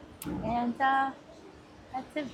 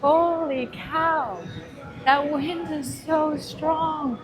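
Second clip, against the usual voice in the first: loud over the roaring wind; shouting over wind; 4 semitones higher, 12 dB louder than usual. Real, not acted. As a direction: shout to be heard outside. On windy pool deck.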